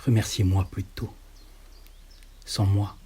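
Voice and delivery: plain reading voice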